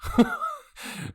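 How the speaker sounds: a short scornful burst of laughter; spat-out laugh. A narrator's reading. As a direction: spit out a bitter laugh. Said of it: "I spit out a laugh".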